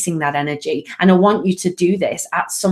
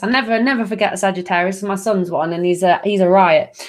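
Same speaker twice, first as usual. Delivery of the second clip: ordinary talk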